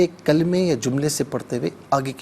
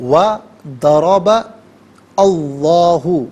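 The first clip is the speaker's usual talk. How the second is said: وَضَرَبَ and اللَّهُ said slowly and louder, each word apart; 8 dB louder, much slower than usual. Shown as a demonstration. Teacher shows viewers each word separately, slow and loud. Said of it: "آپ بغور ملاحظہ فرمائیں".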